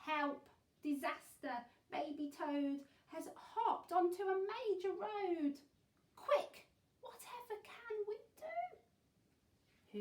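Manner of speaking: loud, high call for help; alarmed cry. A narrator's reading. Reading the baby toad's danger to children, alarmed cry. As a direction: raise the alarm with storybook panic.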